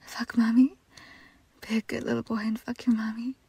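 ordinary talk

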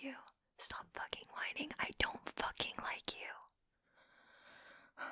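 slow, unvoiced whispered contempt; whispering cruelly to the guy who failed her; cold whisper. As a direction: breathe the insult slowly at him. Cold hushed insults.

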